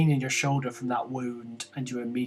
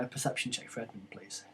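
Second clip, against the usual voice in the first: hushed, quick whispered request; whispering; 9 dB quieter than usual. Real, not acted. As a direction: drop to a whisper.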